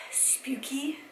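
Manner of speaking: slow spooky whisper on spooky; mock eerie whisper. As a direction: breathe spooky out like a ghost story. Describing spooky costume style.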